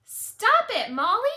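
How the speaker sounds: put-on high, loud whiny kid shout; shrill-free high child yell at a classmate; upset child yelling. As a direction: yell it like a fed-up little kid.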